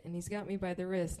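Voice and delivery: ordinary delivery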